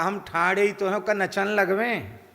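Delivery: drawled Bundeli. Retold or imitated. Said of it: "एक खींच के बोल रहा है".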